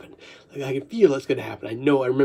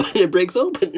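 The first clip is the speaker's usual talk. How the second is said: ordinary talk, nothing marked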